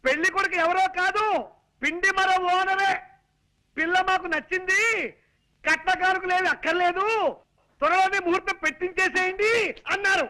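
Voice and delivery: high, emphatic announcement for a deaf listener. Acted. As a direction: shout the good news phrase by phrase. Calling news out to deaf mother-in-law.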